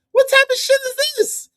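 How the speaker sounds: very high, baffled squeal; high-pitched disbelief. Real, not acted. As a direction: shoot up high in bewilderment. Baffled by the played clip.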